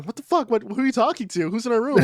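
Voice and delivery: high-pitched mock alarm; startled wife. Wife waking beside husband talking in the dark, startled mock voice. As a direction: imitate someone jolted awake, pitch jumping up. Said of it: "gets up in a start going".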